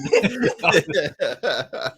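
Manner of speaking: a long, hearty laugh at his own joke. Real, not acted. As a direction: let the laugh roll out after the punchline.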